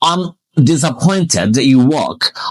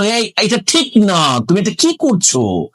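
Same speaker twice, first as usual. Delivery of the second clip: mock indignant protest; 6 semitones higher than usual. Acted protest in Bengali example.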